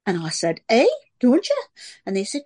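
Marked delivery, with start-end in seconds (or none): 0.7-1.6 put-on loud, high-pitched Sunderland accent; put-on childhood Sunderland accent. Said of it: "I arrived speaking like that".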